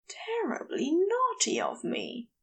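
high, hushed confession; mumbled whisper. Acted. Angel confessing to Crowley in a whisper. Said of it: "Aziraphale mumbles".

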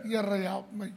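ordinary talk, nothing marked

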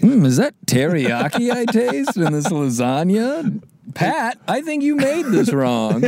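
high, put-on complaining diner; fussy diner voice. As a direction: taste and complain like a picky customer. Acted diner complaining to cook.

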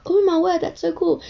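high mock-excited gush; thrilled classmates. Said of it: "everyone else in the class was like".

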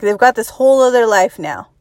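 ordinary delivery

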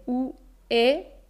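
usual speaking voice

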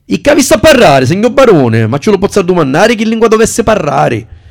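high, loud Sicilian dialect. Acted. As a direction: speak it in broad Sicilian dialect. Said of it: "comprendo che vuoi parlare il siciliano". (dialetto siciliano, acuto e forte)

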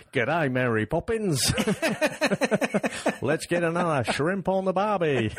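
hammed-up, high-pitched Australian accent; mock Australian accent. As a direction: send up his Cockney as broad Aussie, laughing. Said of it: "he's trying to do the old Cockney accent, but it's very much".

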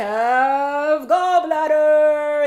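slow, loud, high ring announcer; fighting game announcer. Pretend. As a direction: boom it out like a fight intro. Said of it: "the announcer voice still on".

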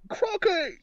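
a high, loud mock "Crocky!"; mock squeal. Riffing on crikey, high squealed joke word.

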